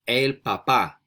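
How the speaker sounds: "El papá" measured and distinct, for repeating; model pronunciation. Shown as a demonstration. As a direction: model "El papá" for learners, stressing the last syllable. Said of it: "I'm gonna say the word three times".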